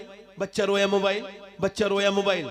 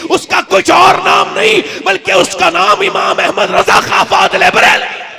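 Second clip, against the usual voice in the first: high, loud, stretched-out oratory; impassioned, drawn-out preacher's shout; full-force preaching; 9 semitones higher, 18 dB louder, slower than usual. Real, not acted. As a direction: thunder it to the whole gathering.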